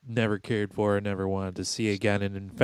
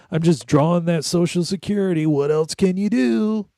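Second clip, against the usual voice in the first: stoner dude voice; 7 semitones higher, 5 dB louder than usual. Retold or imitated. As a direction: shrug it off like a slacker kid. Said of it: "No, he said".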